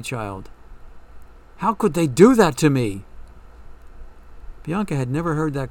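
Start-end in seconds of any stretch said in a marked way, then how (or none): 1.6-3.0 anguished outburst. A narrator's reading. Yoli pleading to Bianca.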